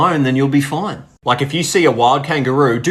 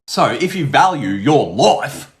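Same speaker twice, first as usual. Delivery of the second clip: slow mock-dire warning; 3 semitones higher, slower than usual.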